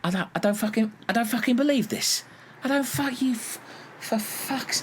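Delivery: high-pitched exasperated sputtering. Acted. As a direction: sputter in disbelief, breaking off mid-curse. Exasperated at crew member's fuel remark.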